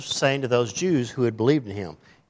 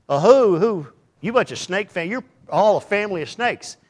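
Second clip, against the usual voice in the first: John the Baptist rebuke; 8 semitones higher, 6 dB louder than usual. Retold or imitated. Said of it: "He said".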